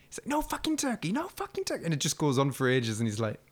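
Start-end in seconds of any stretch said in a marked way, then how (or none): 0.3-1.8 very high-pitched rant, held back in volume; high, repetitive mock fury; mock outrage. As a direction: imitate a meltdown in a squeaky-high voice. Acting out character's rant over turkey.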